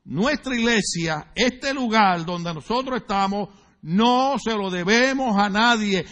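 slow, deliberate, each phrase weighed; slow and emphatic; slowly spelled out. Shown as a demonstration. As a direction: say it slowly so it sinks in. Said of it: "déjeme decirlo despacio".

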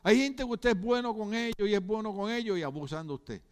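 ordinary delivery

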